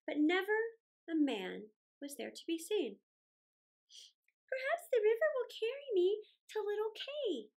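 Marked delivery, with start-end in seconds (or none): 4.5-7.5 high, lightly voiced girl; wistful young girl thinking aloud; hopeful thought. A narrator's reading. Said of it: "thought Gerda".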